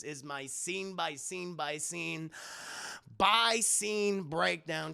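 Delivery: slow, each by scene stretched; drawn-out repeat. Real, not acted. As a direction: savour "scene by scene by scene". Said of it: "drawn-out sign-off".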